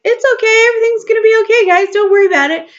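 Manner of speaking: breezy spirit voice. Pretend. Spirit soothing body and mind, high carefree reassurance. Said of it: "the spirit over there hanging out by itself".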